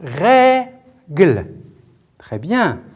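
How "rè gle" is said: "Règle" split as rè, pause, gle; syllable by syllable. Shown as a demonstration. Teacher showing pupils two syllables, "Règle" said as rè... gle. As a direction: break "Règle" into two stressed, drawn-out beats. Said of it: "Quelles sont ces deux syllabes?".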